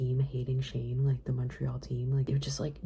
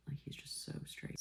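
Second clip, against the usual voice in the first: whisper; 13 dB quieter than usual. Real, not acted. Whispered aside to camera.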